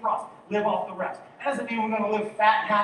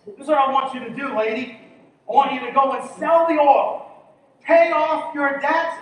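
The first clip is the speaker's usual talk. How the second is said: louder, higher sermon build; preaching at full force; 4 semitones higher, 9 dB louder than usual. Acted. Pastor telling the widow's story, full force. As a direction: drive the story home with force.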